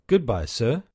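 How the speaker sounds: ordinary delivery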